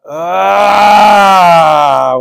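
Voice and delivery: loud pained cry of real hurt. Real, not acted. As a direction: yelp out from the sore hand. Pain in hand.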